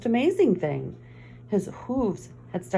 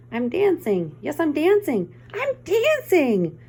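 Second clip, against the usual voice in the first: rising higher and louder with each I'm dancing; joyful cry; 10 semitones higher, 5 dB louder than usual. Acted. Gerald discovering he can dance, building to ecstatic. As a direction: burst out in delighted disbelief. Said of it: "Gerald cried".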